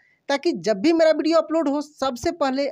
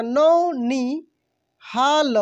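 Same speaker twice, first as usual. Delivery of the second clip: नौनिहाल drawn out very slowly, syllable by syllable; slow sounding out; much slower than usual. Shown as a demonstration. Teacher sounding out नौनिहाल for learners, very slow. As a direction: stretch every sound of नौनिहाल. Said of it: "न में औ की मात्रा".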